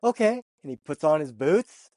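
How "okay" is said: put-on high, loud eager okay from the kid. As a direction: jump at it with a bright high okay. Kid agreeing to chicken farm.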